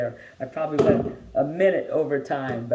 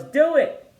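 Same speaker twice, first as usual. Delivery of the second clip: high, loud urging shout; yell; 13 semitones higher, 7 dB louder than usual. A narrator's reading. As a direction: yell it out like an eager friend egging her on. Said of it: "and yells".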